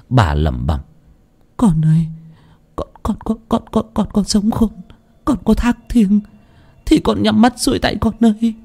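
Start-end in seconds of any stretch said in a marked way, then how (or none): 1.6-8.5 high, broken wailing, stammering con; sobbing plea. Acted. Grieving mother begs her dead daughter to close her eyes, wailing. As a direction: beg the dead daughter through tears. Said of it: "vuốt mặt mà nức nở".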